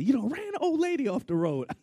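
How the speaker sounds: high-pitched mock police yell; yelled accusation. Officer accusing the pulled-over driver, high yelling. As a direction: accuse like a worked-up cop.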